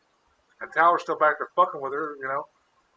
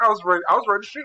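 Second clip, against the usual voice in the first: ordinary talk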